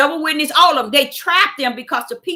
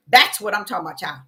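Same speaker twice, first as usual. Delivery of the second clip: ordinary delivery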